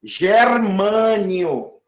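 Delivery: drawn out syllable by syllable; Germânio spelled out slowly, each syllable held; slow demo. Shown as a demonstration. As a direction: stretch it for the class. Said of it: "procuro o nome do elemento".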